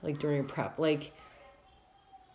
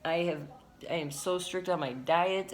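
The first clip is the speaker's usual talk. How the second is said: normal talking voice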